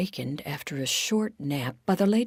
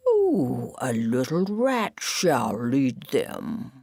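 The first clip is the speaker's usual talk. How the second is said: slow, drawn-out prophetic pronouncement; slow swooping mystical voice; waking Marcy; 4 semitones lower, much slower than usual. Acted. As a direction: stretch it out like a dreamy oracle. Marcy waking, makes solemn pronouncement.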